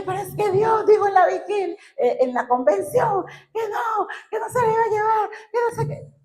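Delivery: high wailing protest, acted out; retold tearful outburst. Wife protesting to husband about God's promise, tearful wail.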